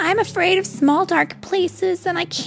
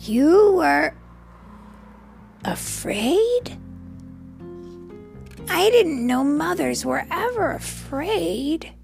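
surprised turtle; 7 semitones higher, slower than usual. Acted. Franklin surprised.

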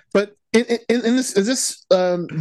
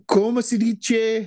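fake Spanish accent; 5 semitones higher, slower than usual. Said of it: "Hilaria Baldwin?".

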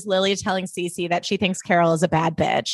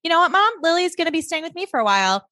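mildly defiant aunt; 8 semitones higher, faster than usual. Retold or imitated. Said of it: "And she's like".

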